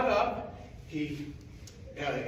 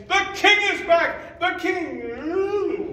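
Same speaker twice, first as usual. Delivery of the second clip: high, loud excited cry; joyful yelling; 12 semitones higher, 10 dB louder, much slower than usual. Acted. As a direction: shout the news to the town. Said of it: "the sentries were saying".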